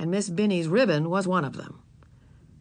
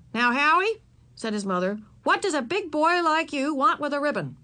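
mother's voice; 7 semitones higher, 3 dB louder than usual. A narrator's reading.

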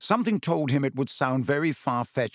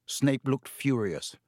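ordinary delivery

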